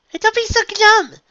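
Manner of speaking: high, loud cheering-up; encouraging. A narrator's reading. Unicorn consoling her glum friend, cheerful encouragement. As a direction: brighten up to lift her spirits. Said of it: "Freddy's look softened".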